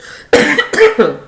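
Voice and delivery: sudden sharp cough. Real, not acted. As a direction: cough once, loud and rough. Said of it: "Excuse me".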